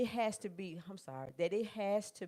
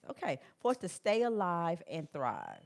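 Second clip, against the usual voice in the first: usual speaking voice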